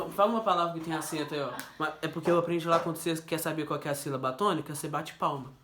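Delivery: usual speaking voice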